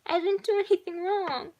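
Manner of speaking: put-on high, loud little-girl wail; acted tearful child, pitch way up; crying protest. A narrator's reading. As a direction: protest your innocence like an upset child. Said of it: "clinging to him and crying".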